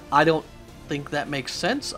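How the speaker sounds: usual speaking voice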